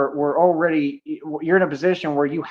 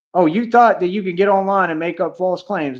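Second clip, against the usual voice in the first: ordinary talk, nothing marked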